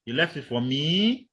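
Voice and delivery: "me" drawn up into a question; doubtful fall-rise. Shown as a demonstration. Teacher demonstrating to class, expressing doubt with a rise. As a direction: doubt it, lifting the pitch on "me". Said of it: "that's you expressing doubt".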